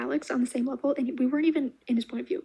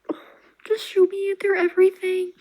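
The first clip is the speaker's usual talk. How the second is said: much louder, higher squeal-like burst; overwhelmed gush; 6 semitones higher, 7 dB louder than usual. Real, not acted. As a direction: gush like it's too much. Overwhelmed gushing about couple.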